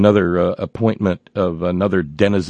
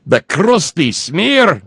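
slow, higher Scottish "The crusty smear."; hammy Scottish drawl; Scottish accent; 9 semitones higher, 5 dB louder, much slower than usual. Pretend. Returns to Scottish catchphrase.

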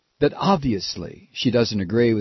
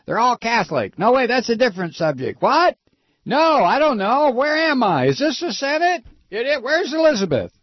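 put-on high, muddled old-man caricature; Strom Thurmond; 10 semitones higher than usual. Host mocks an aged senator losing track, high confused voice. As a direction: lose the thread like a befuddled old senator, high-pitched. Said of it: "he's like Strom Thurmond".

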